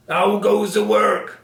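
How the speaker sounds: high, loud, drawn-out farmer's call. A narrator's reading. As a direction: call out slowly as the lazy farmer. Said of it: "called the farmer".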